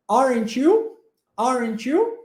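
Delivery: "Aren't you?" with pitch rising sharply on "you"; question intonation. Shown as a demonstration. Teacher models "Aren't you?" for Luan, question intonation. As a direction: end "Aren't you?" on a clear upward question swing on "you". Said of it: "a entonação de pergunta".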